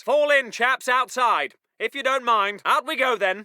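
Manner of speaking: low, unhurried officer; polite calm order. Acted. Officer asking chaps to fall in outside, mildly polite. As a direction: ask them out courteously.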